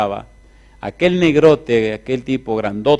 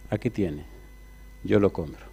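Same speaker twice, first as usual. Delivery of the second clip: calm buyer; 5 semitones lower, 11 dB quieter than usual. Acted.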